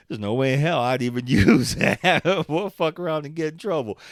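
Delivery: loud, high-pitched laughing protest; real laughter bursting into a loud refusal. Real, not acted. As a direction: laugh hard through the words. Laughing off the copied plot idea.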